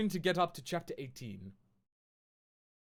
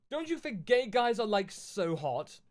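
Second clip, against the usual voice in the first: put-on higher, louder valley-girl author read; mock author voice; 8 semitones higher, 7 dB louder, slower than usual. Streamer reading the author's note to viewers, mock teen-girl voice. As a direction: ham up the teen author gushing.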